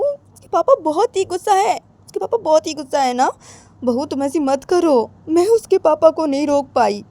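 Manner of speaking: high, anxious mother-in-law voice; mother begging her daughter-in-law not to act, high and anxious; worried old mother. Acted. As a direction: plead as a fretful mother. Mother voice held many lines.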